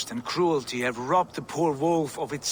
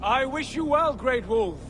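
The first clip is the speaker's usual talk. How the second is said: higher, louder, carrying speech; raised voice; 9 semitones higher, 3 dB louder than usual. Acted. The visitor warns the wolf about the Aesir, raised carrying voice. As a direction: project up to a towering beast.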